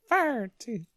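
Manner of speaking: high, soft, squeaky leprechaun Gardaí; mock leprechaun voice. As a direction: play a tiny leprechaun saying Gardaí. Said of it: "That did sound like a leprechaun".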